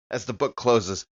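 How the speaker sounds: normal talking voice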